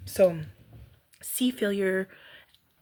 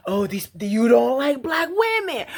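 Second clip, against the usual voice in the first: louder, slow, outraged voice; hammed-up angry ranting, much louder; mock indignant rant; 9 semitones higher, 9 dB louder, much slower than usual. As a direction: spit it out as a wronged rant. Imagined angry rumination.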